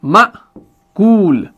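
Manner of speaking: very slow "मअकूल", abrupt stop after "म", then a long "कूल"; hamza jerk demo. Shown as a demonstration. Teacher showing how it is read, slow hamza jerk. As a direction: break "मअकूल" sharply at the hamza and stretch the ending. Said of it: "आप पढ़ते हैं ऐसे".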